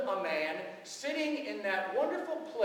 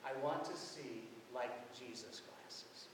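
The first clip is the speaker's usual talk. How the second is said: hushed repeat; 9 semitones lower, 12 dB quieter than usual. Real, not acted. Repeats boy's words, moved.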